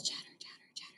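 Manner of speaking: quick whispered chattering; monkey chatter. Shown as a demonstration. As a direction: chatter under the breath like a monkey. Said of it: "Monkeys chatter".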